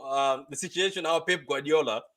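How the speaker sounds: usual speaking voice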